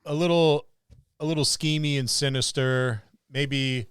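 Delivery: ordinary talk, nothing marked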